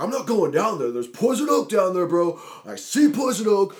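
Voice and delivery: loud, high protest; acted alarmed refusal. Allergic friend refusing to go down the ditch, acted alarm.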